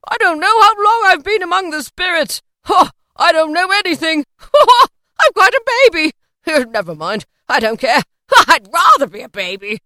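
high, loud, laughing abandon; gleeful crying out. A narrator's reading. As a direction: whoop and laugh between phrases. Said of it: "cried Scrooge".

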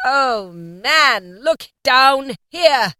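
slow, loud, high spirit's cry; echoing ethereal being. A narrator's reading. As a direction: call out like a ghost from beyond. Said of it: "exclaimed the ghost".

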